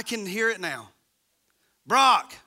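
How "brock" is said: put-on loud call to a child; calling a kid. Parent calling out to son, loud and sharp. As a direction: call the son's name sharply.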